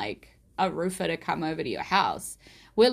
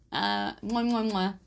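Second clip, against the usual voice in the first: kissing sounds. Pretend. Blowing kisses to the house.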